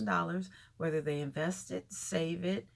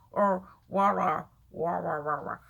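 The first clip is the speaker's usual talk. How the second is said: loud mocking "wah, wah" babble, louder; 8 dB louder than usual. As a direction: parody his mumbling with wah sounds. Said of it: "I hate the way he talks".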